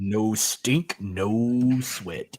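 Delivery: lightly done deep ad-read; Sam Elliott announcer voice. Pretend. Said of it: "like how you do that announcer voice".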